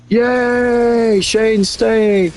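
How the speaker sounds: high, bright cheer of joy; hammed-up cheer for a friend staying; excited cheer. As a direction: celebrate it like a win. Cheering that Shane stays.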